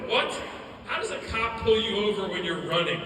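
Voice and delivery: loud mock disbelief; incredulous question. Speaker questions brother's police stop, loud disbelief. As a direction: challenge an absurd story at full voice.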